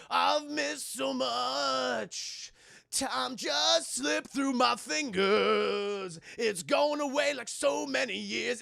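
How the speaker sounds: very slow, loud melodramatic lament; milk the sad montage line; mock dramatic montage. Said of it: "It's like".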